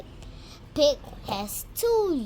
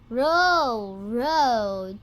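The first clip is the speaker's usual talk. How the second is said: playful roll; 5 dB louder than usual. Acted. Pig rolling in mud.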